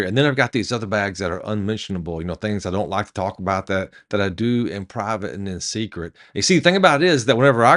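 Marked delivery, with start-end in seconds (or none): 0.0-6.1 low, hushed confession; soft, lowered, acted confiding; confiding admission. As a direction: drop the voice for a private admission. Passenger admits secret, unmentionable bags.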